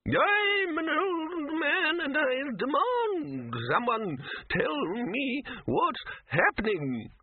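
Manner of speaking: put-on very high, drawn-out wailing old man; indignant old wizard. A narrator's reading. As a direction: demand it in a slow, doddery wail.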